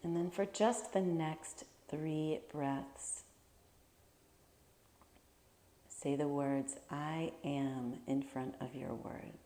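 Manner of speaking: calm, measured meditation voice with long pauses; guided meditation. Real, not acted. Teacher leading listeners to imagine their favorite place, guided meditation. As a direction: guide them gently through a visualization, leaving space to breathe.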